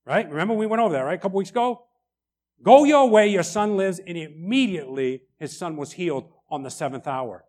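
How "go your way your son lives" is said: Jesus' command. Retold or imitated. Jesus command re-quoted louder.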